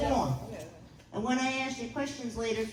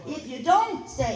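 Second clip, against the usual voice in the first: ordinary talk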